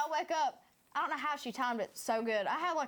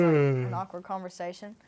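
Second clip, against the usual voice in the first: normal talking voice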